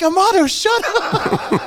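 high, drawn-out retort across the water; hammed-up exasperated call; yelling back. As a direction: imitate hollering back to shut someone up.

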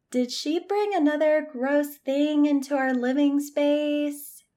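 character voice. Acted. April calls from back bed.

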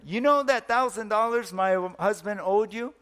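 rising to a loud, high shout on the last words; wife shouting the news out of the window; shouted announcement. Acted. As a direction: call it out across to the neighbour, building. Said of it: "The wife said".

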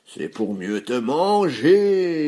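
loud, slow howl rising on the last word; roaring hungry wolf. A narrator's reading. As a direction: howl it out, stretching the final word. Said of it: "hurla le loup".

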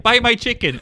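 high, loud mock vendor cry; insistent Vietnamese vendor. Retelling chicken seller hassling men on boat, insistent cry.

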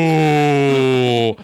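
long, loud imitated audience ooh; crowd "ooh". Whole audience reacting to the insult, loud ooh. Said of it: "the whole audience goes".

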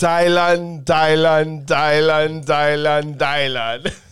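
loud chanted repetition; insistent, drawn-out shout stepping down in pitch; put-on voice. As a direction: belt the word out over and over. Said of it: "This is British?".

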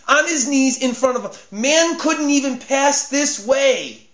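normal talking voice

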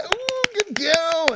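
put-on high, slow baby-talk praise; dad praising his baby girl, high slow baby talk. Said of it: "I say".

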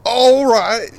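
loud, high hammed-up English accent. Shown as a demonstration. Passer-by gives best English accent for interviewer, English accent. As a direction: belt out a mock English greeting. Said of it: "can I get your best English accent?".

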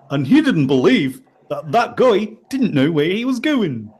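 put-on broad New Zealand accent, sing-song; Kiwi accent. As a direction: say it like a Kiwi voiceover. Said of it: "now we're Welsh".

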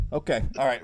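ordinary talk, nothing marked

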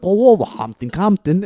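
loud, high Walsall caricature; mock Walsall accent. Imitating Walsall locals for podcast friends, hammed-up Walsall accent. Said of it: "It literally sounds like people".

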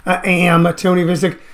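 usual speaking voice

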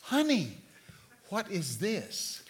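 soft, slow, tender question; preacher softly asking the girl about her necklace. Retold or imitated. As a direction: gently ask the little girl. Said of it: "So I said".

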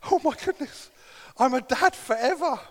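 put-on high, wide-eyed disbelief at the first baby; awed new dad. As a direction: gasp it out like you just became a dad. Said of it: "I'm like".

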